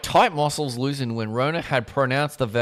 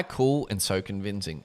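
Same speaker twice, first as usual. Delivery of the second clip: ordinary delivery, nothing marked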